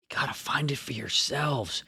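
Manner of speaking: conspiratorial stage whisper. Pretend. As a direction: drop to a hushed, secretive whisper. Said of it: "I don't know why I'm whispering".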